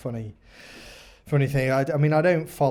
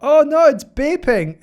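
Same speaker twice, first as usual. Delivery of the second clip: high, loud, drawn-out dismay; hammed-up porn-film panic; mock alarm; 12 semitones higher, 11 dB louder, slower than usual. Mock porn scene, feigned alarm.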